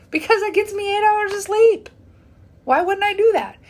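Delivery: exasperated retort. Retold or imitated. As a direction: defend yourself like it's obvious.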